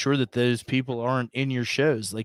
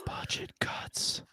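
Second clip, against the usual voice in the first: slow, hushed whisper; 13 dB quieter, slower than usual. Pretend. Co-host repeats running joke to friends, whispered. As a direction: breathe it out as an ominous aside.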